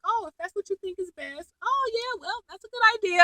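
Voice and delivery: high, soft mock-agreeable sarcasm; Cyrus. Cyrus goading a ranting David Rosen, sarcastic agreement. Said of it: "being so sarcastic".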